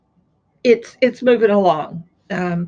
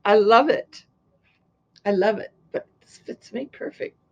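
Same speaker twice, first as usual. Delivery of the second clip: normal talking voice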